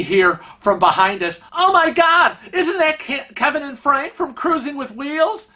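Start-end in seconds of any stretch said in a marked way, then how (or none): 1.5-5.5 excited fan. Retold or imitated. Excited fans recognizing them.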